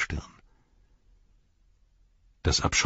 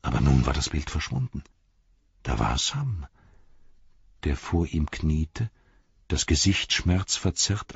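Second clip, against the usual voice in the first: usual speaking voice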